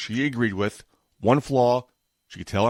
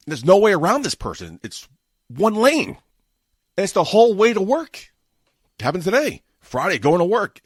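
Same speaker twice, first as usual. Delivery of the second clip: loud, high exasperated rant; angry rant; 5 semitones higher, 5 dB louder than usual. Real, not acted. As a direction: vent it loud and fed up. Ranting about slow minivan.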